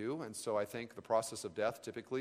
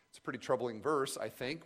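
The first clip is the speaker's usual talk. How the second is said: ordinary talk, nothing marked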